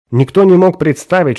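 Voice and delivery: plain reading voice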